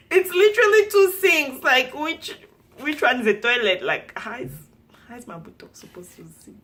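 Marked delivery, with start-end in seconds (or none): 0.0-3.8 high, loud incredulous outburst; baffled and loudly amused; loud disbelief. Retold or imitated. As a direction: exclaim it in comic disbelief, voice pitched right up. Incredulous retelling of toilet confusion.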